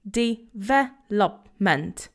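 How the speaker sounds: very slow Development, each syllable drawn out. Shown as a demonstration. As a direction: spell out Development slowly for learners to copy. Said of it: "repeat after me".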